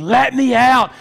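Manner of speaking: loud high shout of a caged beast; a caged dog's cry to be let out; snarling dog. Retold or imitated. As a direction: roar it like a dog straining at the cage. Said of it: "as though it's a snarling dog".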